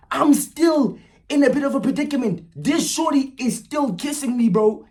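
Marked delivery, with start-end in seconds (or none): none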